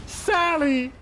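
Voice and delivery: high-pitched chipmunk melodrama; hammed-up betrayed cry, drawn out; heartbroken chipmunk. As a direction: wail it high like a jilted cartoon chipmunk. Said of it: "one of them will just be in denial".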